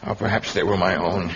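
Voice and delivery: normal talking voice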